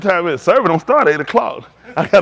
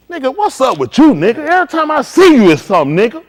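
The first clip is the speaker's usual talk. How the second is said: louder, higher, picking a fight; confrontational approach; 6 semitones higher, 6 dB louder than usual. Acted. Confronting a brother the wrong way, loud and hostile. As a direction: get up in his face about it. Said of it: "Y'all see me try, who see me try to talk to him?".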